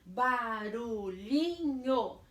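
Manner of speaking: "Barulhinho, sossego" slow, every syllable marked. Shown as a demonstration. Teacher reviews the list, "Barulhinho, sossego" syllable by syllable. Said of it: "cada S numa sílaba".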